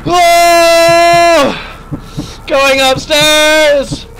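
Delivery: high, loud yelp then stretched cry; startled shout. Real, not acted. Gamer startled by the monster, shouted alarm. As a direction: jump and flee shouting.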